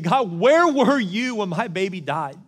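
loud, high, drawn-out anguished cry; yelling out to God. Retold or imitated. Bereaved father demanding answers from God in prayer, yelling. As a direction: hurl the question at heaven, slow and full-voiced. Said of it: "I remember yelling out to God".

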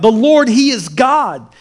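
high, loud, slow proclamation; crying out. Acted. People see the fire fall, crying out. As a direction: shout it as an awestruck crowd. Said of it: "they cried out".

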